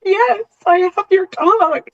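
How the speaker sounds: fighting back tears while telling it; intermittent tremor. Real, not acted. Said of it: "sorry, I get emotional".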